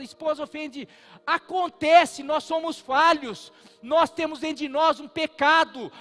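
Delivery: full-force preaching. Acted. Pastor preaching hard to the congregation about hurting loved ones, loud and fervent. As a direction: drive the point home from the pulpit.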